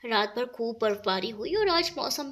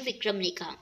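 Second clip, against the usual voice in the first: plain reading voice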